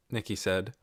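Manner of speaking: ordinary delivery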